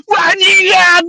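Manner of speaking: high, shouted curse; imitated joint yell. Two friends cursing together after work, high yell. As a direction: cry it out high as if in chorus. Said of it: "kita berdua ngomong".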